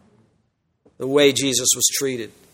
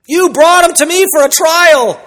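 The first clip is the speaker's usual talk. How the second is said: high, louder exasperated retort; frustrated; 12 semitones higher, 7 dB louder than usual. Retold or imitated. Pilate fed up with the leaders, high exasperated retort. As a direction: throw it back at them in exasperation. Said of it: "I think he's frustrated".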